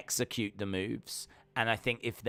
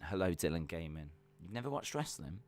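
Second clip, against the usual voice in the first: ordinary talk, nothing marked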